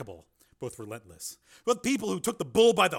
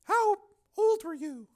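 Donna asking; 3 dB louder, slower than usual. Acted. Donna asks Steele gently.

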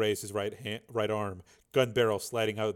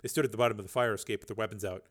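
ordinary delivery

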